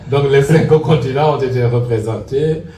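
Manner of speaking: laughing. Real, not acted. Laughing after continents mix-up.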